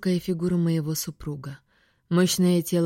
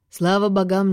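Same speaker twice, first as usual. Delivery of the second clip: ordinary delivery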